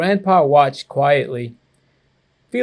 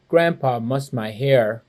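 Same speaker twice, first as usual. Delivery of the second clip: plain reading voice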